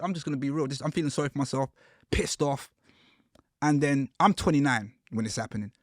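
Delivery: ordinary talk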